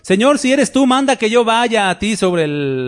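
high-pitched call, no real shouting; mock cry across the water; retold calling out. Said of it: "a Pedro gritó".

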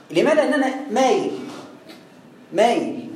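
ordinary talk

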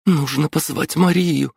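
put-on a girl desperate to fetch help; urgent young girl. A narrator's reading. Captive girl urges them to call Maria, in a high, urgent voice.

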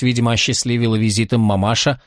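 plain reading voice

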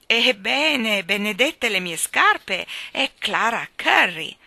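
loud, slow, astonished greeting; drawn-out cry of happy recognition; hearty shout. A narrator's reading. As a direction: boom it out to a long-lost friend. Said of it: "gridò il signor Wigg".

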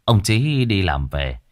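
ordinary delivery, nothing marked

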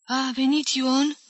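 put-on slow, high, lost old-woman question; bewildered mumble. A narrator's reading. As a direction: ask it slowly, all confused. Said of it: "bolborosi rătăcită bătrâna".

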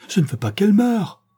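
high, desperate outcry; anguished cry. A narrator's reading. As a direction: cry out in protest, pitch leaping up. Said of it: "m'écriai-je". (cri désespéré)

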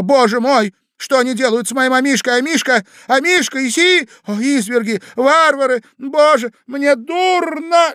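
high, loud hysterical outburst; lady crying out. A narrator's reading. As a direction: wail in rising alarm like a distraught lady. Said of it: "закричала дама". (истеричный крик дамы)

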